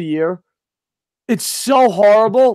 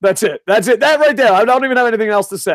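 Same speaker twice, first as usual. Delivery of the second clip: ordinary talk, nothing marked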